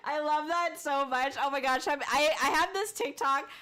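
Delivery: ordinary talk, nothing marked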